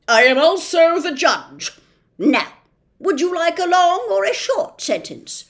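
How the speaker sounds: loud, slowish officious policeman; pompous policeman. Acted. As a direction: lay down the law with bluster. Judge voice.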